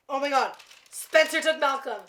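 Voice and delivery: normal talking voice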